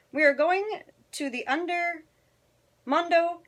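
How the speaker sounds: high Spanish-flavoured dog voice; Chihuahua pack. Acted. Chihuahuas answering Scipito, high-pitched announcement. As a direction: pitch it up as a band of little dogs announcing.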